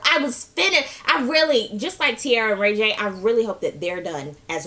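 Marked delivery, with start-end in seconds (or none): none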